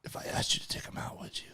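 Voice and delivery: hushed conspiratorial whisper. Acted. Host secretly asking a friend to take someone out, whispering. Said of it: "why are we whispering".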